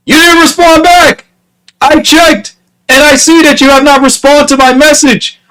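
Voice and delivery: high-pitched, aggrieved accusation; acted exasperated complaint; indignant confrontation. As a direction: call them out, voice pushed way up. Acted quarrel over ignored message.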